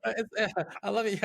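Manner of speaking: ordinary talk, nothing marked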